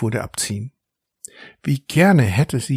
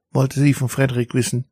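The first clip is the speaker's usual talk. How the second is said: ordinary delivery, nothing marked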